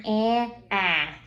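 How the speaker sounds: An nasal, then ah open; contrasting vowels. Shown as a demonstration. Teacher contrasting two A sounds for a child. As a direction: set An against an open ah. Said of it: "the A sounds very different".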